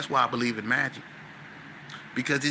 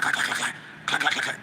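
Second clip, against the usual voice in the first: clacking door imitation. Imitating door lock sound.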